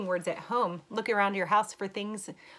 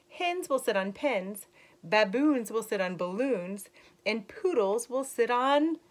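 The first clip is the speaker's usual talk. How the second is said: story reading; 3 semitones higher, 6 dB louder than usual. Acted. Teacher reading a rhyming book to young kids, lilting. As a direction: read the rhyme brightly to the class. Reads picture book to children.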